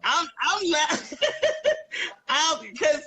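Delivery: real laughter breaking through the words; can't stop laughing while trying to talk. Real, not acted. Cohost cracking up at agreeing with Art, laughing. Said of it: "I'm laughing because".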